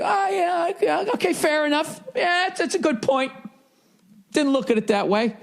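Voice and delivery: high, sweet sudden agreeing; mock reasonable agreement. Screaming driver suddenly conceding to bystanders, mock polite.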